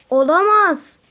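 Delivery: high loud alarmed cry. Acted. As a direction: shout in sudden alarm. Hero senses danger.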